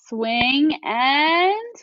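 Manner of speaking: very slow, stretched; long suspenseful drag. Shown as a demonstration. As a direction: hold it out for suspense. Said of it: "more ellipses".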